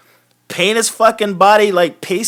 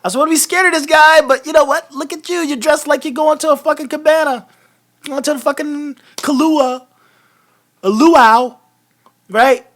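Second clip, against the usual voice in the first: lightly voiced carefree goofball, a bit higher; breezy mock Ambrose shrugging it all off; imitating Ambrose; 7 semitones higher than usual. Host mocking Ambrose shrugging off a beatdown, light goofy imitation. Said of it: "He's just like".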